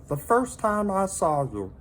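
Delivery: high little creature voice, gently pitying; a tiny pet breaking hard news kindly; sympathetic salamander voice. A narrator's reading. Minton consoling lonely Hector, high sympathetic voice. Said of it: "Minton told him".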